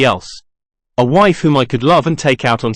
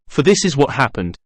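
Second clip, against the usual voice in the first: usual speaking voice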